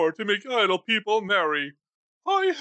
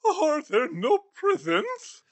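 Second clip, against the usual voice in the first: sarcastic scorn; 6 semitones higher, slower than usual. Acted. Scrooge scorns charity collectors.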